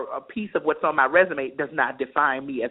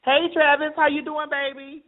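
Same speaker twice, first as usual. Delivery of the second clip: flirty high voice; 11 semitones higher than usual. Acted. Flirty greeting to caller.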